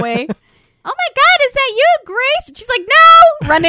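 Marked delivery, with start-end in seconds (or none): 0.3-2.5 very high, slow gushing fan voice; hammed-up squealing fan; mock starstruck fan. Fan recognising a star. 2.8-3.5 very high, loud yelp; hammed-up panicked cry; mock yelp. Fleeing fan, acted quote.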